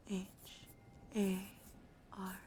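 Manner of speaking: normal talking voice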